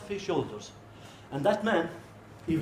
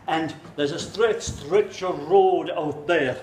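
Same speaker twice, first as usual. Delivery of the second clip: plain reading voice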